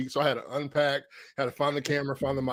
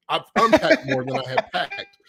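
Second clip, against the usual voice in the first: laughing through the words, much higher; 13 semitones higher than usual. Real, not acted. Host laughing to cohost about endless unpacking, laughing. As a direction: chuckle it out at yourself.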